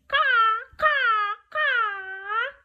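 high, loud, drawn-out caws; crow cawing. Shown as a demonstration. Storyteller leading children as crows, high loud cawing. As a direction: caw like a crow. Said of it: "Can you be a crow with me?".

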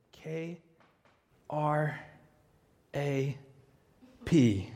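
each letter said apart with long pauses; spelled out slowly. Retold or imitated. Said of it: "And so he said".

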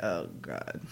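very low, quiet mock groan; dismayed muttering. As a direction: mutter it under the breath in dismay. Acting Lee's dismayed reaction.

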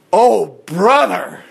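slow, loud, drawn-out groan of oh brother; long exasperated oh brother. Real, not acted. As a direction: sigh it out, fed up. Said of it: "exasperated reaction".

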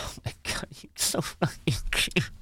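put-on whispered baby talk to a puppy; cooing whisper. Owner whispering to his tiny puppy, soft coo. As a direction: breathe it out softly, melting over the dog. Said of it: "I'm like".

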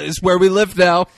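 hammed-up settler declaration; mocking settler. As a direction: declare it big, as a joke. Mock speech of settlers.

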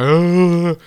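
loud, high charging cry; imitated battle yell. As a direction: yell as if running to tackle. Imitating own charge at man.

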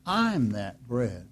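slow, deliberate, hushed; slow solemn quote, spoken gently. Retold or imitated. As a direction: give it weight, slowly. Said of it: "He says".

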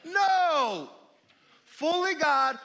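loud, high, forceful no; emphatic shout. Real, not acted. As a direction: hit it hard and high. Preacher answers his own question.

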